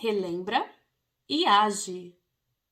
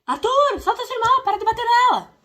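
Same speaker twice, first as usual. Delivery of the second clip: much higher, much louder shout across the room; yelling from afar; 13 semitones higher, 11 dB louder than usual. Retold or imitated. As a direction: yell at the kids from far away. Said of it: "adianta gritar de longe".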